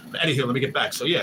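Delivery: ordinary talk, nothing marked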